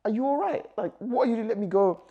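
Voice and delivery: high-pitched, incredulous retold protest; pissed off. Man demanding his partner let him go, pissed off. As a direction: pitch it way up, fed up. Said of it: "I'm pissed at this point".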